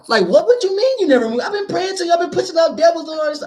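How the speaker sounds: high-pitched mock pleading, indignant; incredulous protest. Rejected believer pleading with the Lord, mock incredulous protest.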